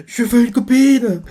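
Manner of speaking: high, pleading little voice; whiny pining Dot. Retold or imitated. Dot pining aloud for a girlfriend, high whine. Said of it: "on voit Dot qui fait".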